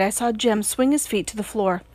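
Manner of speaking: ordinary delivery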